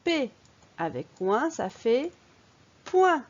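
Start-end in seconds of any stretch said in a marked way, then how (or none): 2.9-3.2 "point" pitched high and loud as one syllable; model for repeating. Shown as a demonstration. Teacher blends P with ouin for the pupil, emphatic. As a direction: punch out "point" high for the learner to copy. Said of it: "À ton tour".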